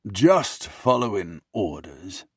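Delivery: slow, drawn-out sarcastic butler voice; dripping sarcasm. Acted. Butler excusing himself to the prince, dripping sarcasm. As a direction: let every word drip with sarcasm. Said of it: "his words drip with sarcasm".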